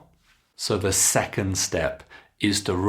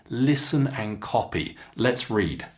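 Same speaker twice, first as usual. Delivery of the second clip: usual speaking voice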